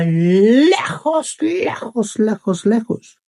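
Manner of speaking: drawn-out words leaping high; high, lingering storyteller emphasis; stretched for distance. Acted. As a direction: stretch each word into the far distance. Horse stresses how far away.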